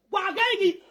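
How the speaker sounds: normal talking voice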